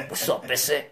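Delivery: low, soft Mexican crooner greeting. Retold or imitated. Wicked greets Danger, low Mexican smooth-voice impression. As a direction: imitate a deep-voiced Mexican soul singer.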